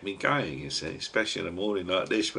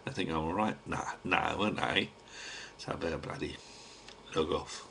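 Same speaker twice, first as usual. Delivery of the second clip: real hush, softer now the workmen are here; quiet sign-off, trying not to be overheard; 3 semitones lower, 10 dB quieter than usual. Real, not acted. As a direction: keep it down so the carpenters won't hear. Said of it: "I better shut me mush now".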